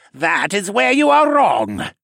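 high, slow, loud menacing hiss. A narrator's reading. Senna contradicting Siskern while clutched by the throat, hissing. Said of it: "Senna hissed".

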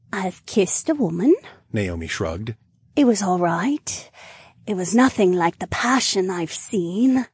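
sincere tipsy woman; young woman voice. Acted. Naomi voiced.

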